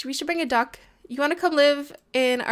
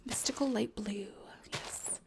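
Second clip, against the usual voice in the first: whisper; 3 semitones lower, 10 dB quieter than usual. Real, not acted. Whispered aside about flowers.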